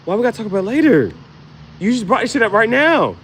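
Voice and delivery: fast, loud, high protest; a loud indignant why now. Acted. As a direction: fire back in outrage. Acted indignant reply.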